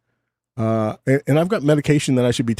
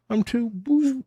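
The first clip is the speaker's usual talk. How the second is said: high, put-on snooty voice on "I'm too bougie"; hammed-up fancy self-parody; mock bougie; 13 semitones higher, 5 dB quieter than usual. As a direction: play the snob much higher than your own voice. Mocking own fancy taste.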